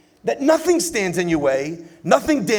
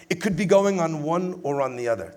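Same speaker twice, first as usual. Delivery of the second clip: usual speaking voice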